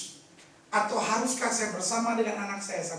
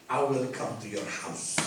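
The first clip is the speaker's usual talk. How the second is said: calm Jesus; 11 semitones lower, 4 dB quieter, slower than usual. Acted. Jesus's words quoted.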